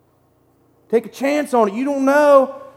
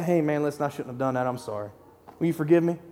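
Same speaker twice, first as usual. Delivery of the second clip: much lower and softer, a sheepish apology; humble apology; 9 semitones lower, 12 dB quieter, faster than usual. Pretend. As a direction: hang your head and ask forgiveness quietly. Acted apology to a coworker.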